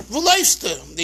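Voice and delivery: "Wo" said as "voo", Russian-style Yiddish; Russian Yiddish vowel, "voo" for "Wo"; Russian Yiddish accent. Retold or imitated. Rebbe asks his running student, in Russian-accented Yiddish. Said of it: "is the Russian accent".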